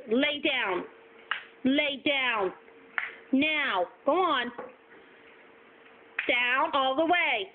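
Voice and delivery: normal talking voice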